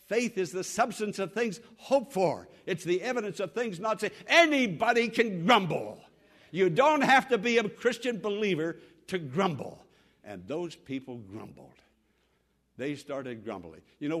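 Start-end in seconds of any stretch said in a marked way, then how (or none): 4.3-9.9 louder, emphatic pulpit delivery; forceful preaching. Real, not acted. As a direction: drive the point home from the pulpit.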